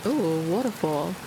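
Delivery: ordinary delivery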